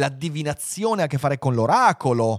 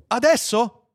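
high, anxious asking; eager question; 10 semitones higher, 4 dB louder, slower than usual. Acted. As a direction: ask it high and impatiently. Anxious man asks the computer. (domanda acuta e impaziente)

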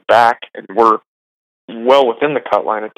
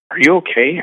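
higher-pitched imitation of a concerned girlfriend; lightly voiced worried question, pitched up; 7 semitones higher than usual. Girlfriend checking on the crying caddie, voiced as concerned.

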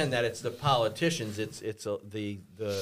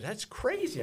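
higher, softer imitated disbelief; retold amazement; 8 semitones higher, 6 dB quieter than usual. Woman amazed by a man's gun, retold disbelief. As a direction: imitate her being amazed, hushed and high. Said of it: "she was amazed by this whole thing".

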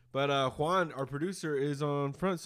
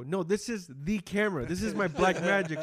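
higher, louder mock exasperation; exasperated retort; 9 semitones higher, 6 dB louder than usual. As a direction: insist it's the real camera, exasperated. Retold argument with Gabby.

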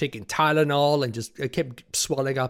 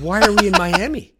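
indignant; 7 semitones higher, 9 dB louder than usual. Retold or imitated. Retold indignant question on plane.

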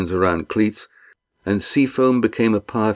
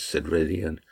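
ordinary delivery

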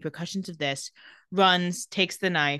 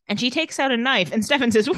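usual speaking voice

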